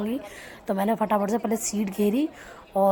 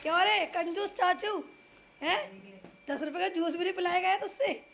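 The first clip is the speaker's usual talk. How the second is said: high-pitched, softer teasing telling-off; mock scolding; 7 semitones higher, 7 dB quieter than usual. Real, not acted. Aunt teases stingy uncle over unbought juice, mock scolding.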